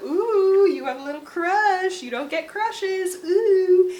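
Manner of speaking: high, loud mocking "ooh"s; teasing sing-song. Best friend teasing Hyacinth about her crush, in a high sing-song. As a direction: tease her like a nosy little sister.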